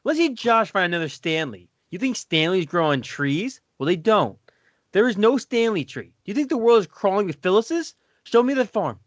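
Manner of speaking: hammed-up outrage, pitched high; indignant monologue. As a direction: plead the case in high, swooping protest. Performs Michael's indignant monologue.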